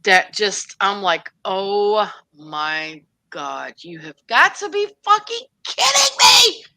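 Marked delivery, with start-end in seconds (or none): 1.4-6.6 mock outrage, erupting at the end; exasperated outburst. Retelling outraged reaction to a show.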